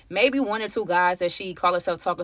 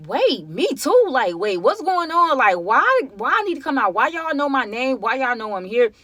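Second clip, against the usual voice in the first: higher, louder, rising frantic questions; shocked she hears her own name, panicking; panicked disbelief; 6 semitones higher, 5 dB louder than usual. Retold or imitated. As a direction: spiral into fearful confusion. Panicked realisation her name was called.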